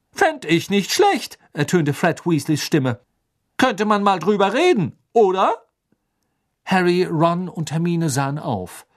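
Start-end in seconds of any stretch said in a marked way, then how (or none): 0.1-1.4 high, drawn-out, loud interjection; Fred Weasley. A narrator's reading. Fred schaltet sich plötzlich ein. 3.6-5.5 high, loud, cheeky interjection; Fred Weasley. A narrator's reading. Fred redet weiter dazwischen.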